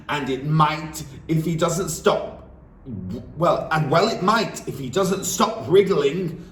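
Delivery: loud, high scornful retort; snorting scorn. Acted. Headmistress scoffing at the teacher's plea, loud. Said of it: "snorted the Trunchbull".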